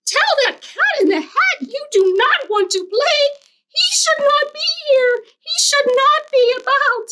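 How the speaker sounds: very high, squeaky scolding fish; fussy fish voice. Acted. Fish warning the kids about the cat, high and fussy. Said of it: "our fish said".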